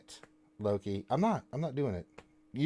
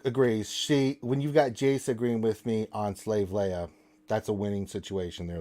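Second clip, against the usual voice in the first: ordinary delivery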